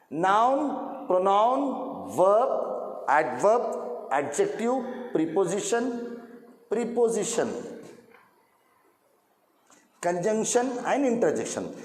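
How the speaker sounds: each part of speech said slowly and drawn out, for the class to echo; slow recitation for repeating. Shown as a demonstration. Teacher drilling students, reciting slowly for them to repeat. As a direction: spell out the list slowly so students can read after you. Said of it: "You can read after me also".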